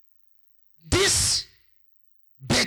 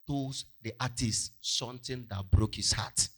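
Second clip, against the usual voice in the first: plain reading voice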